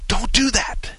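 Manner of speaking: high, sharp warning shout; shouting a warning at a stranger about to touch the clamps; retold yell. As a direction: yell it to stop him in time. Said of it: "and I yell".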